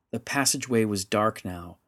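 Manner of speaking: ordinary delivery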